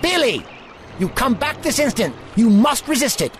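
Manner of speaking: desperate calling out. Acted. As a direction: call him back with authority. Doctor calling after fleeing boy.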